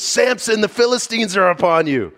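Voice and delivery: high, loud alarmed shout; alarmed call. Acted. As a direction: sound the alarm to wake him. Delilah raising false alarm.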